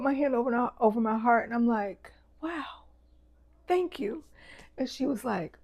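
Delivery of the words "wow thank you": touched surprise. Retold or imitated. Touched by stranger's compliment.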